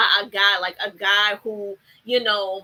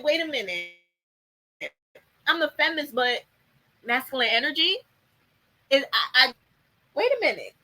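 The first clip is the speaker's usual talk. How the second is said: flustered; 3 semitones higher than usual. Retold or imitated. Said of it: "She didn't know what this was".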